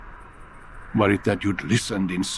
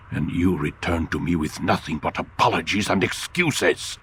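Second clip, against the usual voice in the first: ordinary talk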